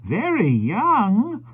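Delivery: put-on high, very slow old man; musing old gentleman, drawn out; slow, high elderly voice; old stranger's voice. Old stranger remarking on the wife.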